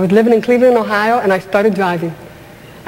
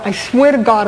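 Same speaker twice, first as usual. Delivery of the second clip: ordinary talk, nothing marked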